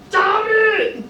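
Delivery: put-on high squeaky child voice yelling stop; child's cry. Teacher voicing a tickled toddler, high child yell. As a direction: play the tickled child protesting.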